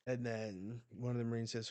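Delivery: ordinary talk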